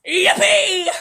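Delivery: high, loud gleeful whoop; old man whooping for joy; yelled out. A narrator's reading. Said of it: "he yelled out".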